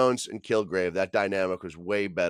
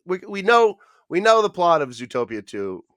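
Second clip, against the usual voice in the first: raised exasperated voice; loud; 8 semitones higher, 8 dB louder than usual. Real, not acted. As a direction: push it out, fed up. Exasperated rant.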